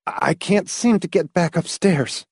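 husky, helpless voice. A narrator's reading. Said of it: "he said huskily".

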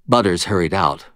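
ordinary delivery

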